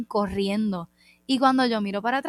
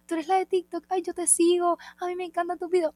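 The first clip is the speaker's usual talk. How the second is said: gushing fan; 9 semitones higher than usual. Retold or imitated. Fan girl voiced in story.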